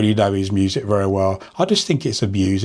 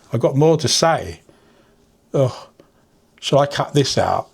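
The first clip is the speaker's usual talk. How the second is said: eyes watering; 4 semitones higher than usual. Real, not acted. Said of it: "My eyes are watering".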